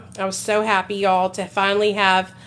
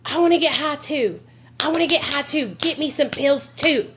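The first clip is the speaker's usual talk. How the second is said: higher-pitched, re-enacted demand; retold insistent demand; 6 semitones higher than usual. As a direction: act out demanding your share. Retells demanding drugs from husband.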